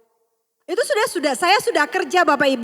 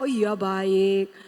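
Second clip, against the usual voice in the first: father's low slow reply; 7 semitones lower, much slower than usual. Retold or imitated. Father answering his son's phone call, low and slow. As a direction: drop lower and slow right down for the father.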